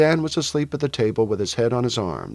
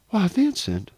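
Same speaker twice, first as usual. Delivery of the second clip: mildly startled mother, higher pitch; startled exclamation; 6 semitones higher, slower than usual. A narrator's reading. Mother sees her son arrive in rough clothes, mildly startled. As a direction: exclaim in mild surprise. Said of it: "Missus Wingfield exclaimed, starting up".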